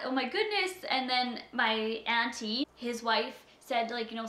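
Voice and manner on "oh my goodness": high, breathy-free gasp of amazement, retold; a startled, much higher exclamation of wonder; amazed oh my goodness. Family at the bedside reacting to him, high amazed exclamation. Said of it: "like that".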